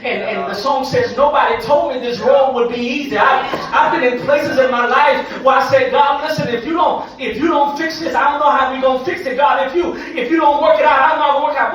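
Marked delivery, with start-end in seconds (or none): 1.2-11.7 loud, urgent preached plea; impassioned testimony. Real, not acted. Preacher recalling his plea to God, impassioned testimony. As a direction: press each repeated condition harder.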